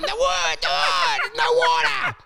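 rising to a loud, high shout; mock insistent shout. Comic shouting the punchline at confused pub strangers, mock shout. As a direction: push the punchline on them, yelling. Urging strangers to get joke.

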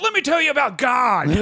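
a hint of a preachy kid on stage; preachy young comic. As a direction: lecture the crowd grandly.

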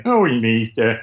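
cartoon dad grumble; lightly voiced cartoon dad; Homer Simpson voice. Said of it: "like this".

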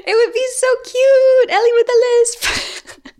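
high cooing voice through giggles. Real, not acted. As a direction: coo at her like she's adorable. Teasing cooing over friend's lisp.

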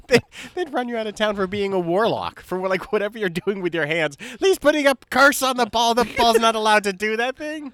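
loud, high, laughing outrage; excited laughing rant. Real, not acted. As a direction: rant in delighted mock outrage. Mock outrage, old-timers.

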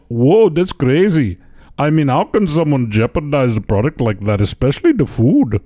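lightly put-on Indian accent. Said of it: "Your accents are getting better".

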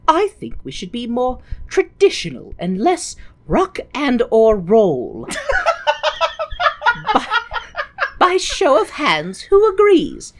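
a lightly hammed stern nun; prim reverend mother. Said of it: "mother reverend is like".

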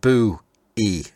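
Bowie split into two parts, BOO, EE; spelled-out pronunciation. Shown as a demonstration. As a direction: sound out "Boo E" syllable by syllable. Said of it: "but he's got the".

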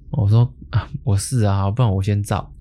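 normal talking voice

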